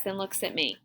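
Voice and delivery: ordinary delivery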